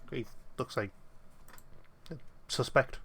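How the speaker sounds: ordinary talk, nothing marked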